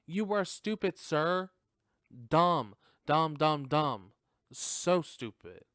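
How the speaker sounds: loud, scornful berating of a dumb character; exasperated shout of dumb; loud scolding. Real, not acted. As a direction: bark it at the screen. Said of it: "scolding the character loudly".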